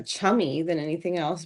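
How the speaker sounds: normal talking voice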